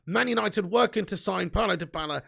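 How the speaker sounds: ordinary delivery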